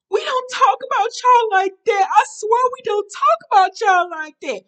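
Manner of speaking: very high, loud mock wail; Kaylin. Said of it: "So Kaylin was like".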